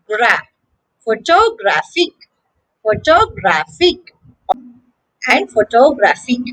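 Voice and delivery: "gra" alone, then pho-to-GRAPH-ic; "photographic" with the weight on GRAPH; third-syllable stress. Shown as a demonstration. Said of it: "the stress is on the third syllable".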